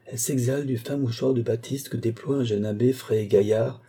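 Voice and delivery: ordinary delivery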